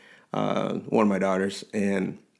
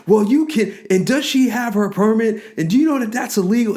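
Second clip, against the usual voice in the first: much higher, louder, rushed mock scream; imitating guard screaming; 10 semitones higher, 4 dB louder than usual. Guard screaming at the dad about the permit, mock yell. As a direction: fire off the questions like a shouting guard. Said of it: "he's screaming".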